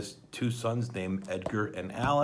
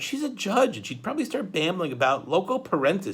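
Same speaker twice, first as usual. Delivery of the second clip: put-on much higher girl voice; a girl's exasperated explanation; Violet; 9 semitones higher, 4 dB louder than usual. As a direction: point it out with frustration. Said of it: "Violet pointed out".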